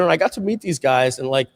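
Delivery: ordinary talk, nothing marked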